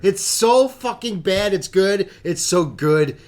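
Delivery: ordinary talk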